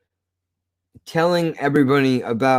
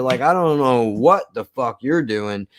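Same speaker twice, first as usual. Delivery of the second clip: usual speaking voice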